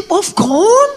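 high, loud puzzled Popcorn? in a put-on foreign accent; mock foreign accent. Teller mimicking the schoolgirl's foreign-accented Popcorn. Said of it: "a foreign accent".

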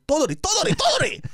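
high, loud, escalating yells; screaming mom. Retold or imitated. Mom to her uncomprehending son, screaming. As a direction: imitate a mother climbing to a shriek. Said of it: "She's screaming".

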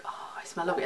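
soft self-satisfied whisper; mock-smug whispered boast. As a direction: whisper it pleased with yourself. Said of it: "just be like".

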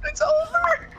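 high, crying mock death cry; melodramatic wail. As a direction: ham up a dying farewell.

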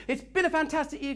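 crazy. Shown as a demonstration. Trainer showing the room a crazy take. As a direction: throw it out like you've lost it.

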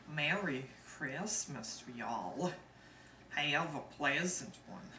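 slow Southern US drawl; Southern accent. Shown as a demonstration. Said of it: "here's the Southern accent".

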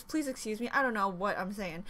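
ordinary talk, nothing marked

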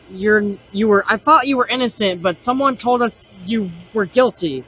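normal talking voice

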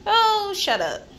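high, loud dismissive snap, shut up dragged out slowly; real exasperated shout; fed-up scolding. Real, not acted. Scolding someone in her way.

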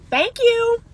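high, sugary thank you; a high, dainty thank-you; sweet grateful lady. Pretend. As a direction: play the delighted lady. Acting grateful woman at door.

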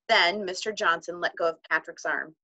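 ordinary delivery, nothing marked

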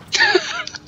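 short burst of high laughter; high, sudden giggle; laughing. Real, not acted. Laughing at the game.

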